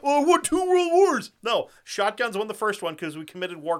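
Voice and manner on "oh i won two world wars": high, loud sneering mimicry; mock the other side's smug boast; shrill parody of a gun snob; mocking quote. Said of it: "I'm just so sick of that argument".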